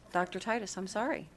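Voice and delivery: normal talking voice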